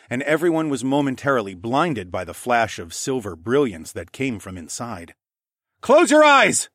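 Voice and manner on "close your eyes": high, loud urgent bellow; bellowed warning. Acted. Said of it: "I bellowed from above".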